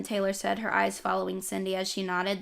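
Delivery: ordinary delivery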